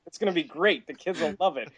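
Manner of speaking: high-pitched mock sales talk; lightly voiced exec, amused and eager; pitching executive.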